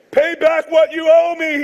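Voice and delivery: loud, high, forceful demand. A narrator's reading. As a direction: shout it like a creditor collecting. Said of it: "he demanded".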